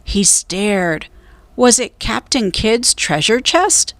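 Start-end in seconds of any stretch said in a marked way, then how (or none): none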